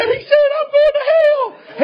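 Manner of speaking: mock terrified sob of a scared boy; crying out. Scared boy wailing to his friend, high crying shout. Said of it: "He starts crying".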